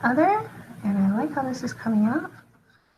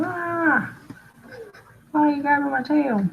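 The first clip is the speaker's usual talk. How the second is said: higher-pitched indignant complaint; mad lion; 6 semitones higher than usual. Acted. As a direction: play an affronted lion, voice jumping up. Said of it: "so he's mad".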